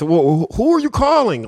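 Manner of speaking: high, puzzled voice of the man on the phone; guy on the phone. Retold or imitated. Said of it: "the guy says".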